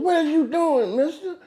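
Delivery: high, alarmed nurse voice; a nurse catching someone in the act; startled nurse. Retold or imitated. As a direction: nurse finding him slapping the patient, alarmed. Nurse catches him slapping.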